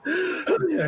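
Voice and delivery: gasped, then high-pitched freak-out; half-laughing, hyperventilating disbelief. Retold or imitated. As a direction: build from a breathless gasp to a high squeal. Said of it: "I start, like, freaking out".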